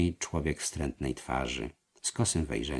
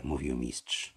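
ordinary delivery, nothing marked

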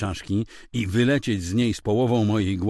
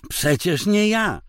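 high, slow, louder innocent explaining; matter-of-fact excuse; 11 semitones higher, 6 dB louder, slower than usual. Acted. Wife to husband, offhandedly denying blame. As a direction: shrug off blame like a lost charger. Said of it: "powiedziała to tak, jakby tłumaczyła".

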